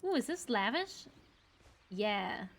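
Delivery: ordinary talk, nothing marked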